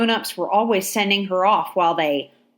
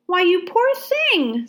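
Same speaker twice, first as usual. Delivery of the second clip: put-on much higher, slow little girl's voice, full of pity; 10 semitones higher, slower than usual. A narrator's reading. Ladysmith pities the barefoot soldier, slow girl voice. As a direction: coo it like a sympathetic child.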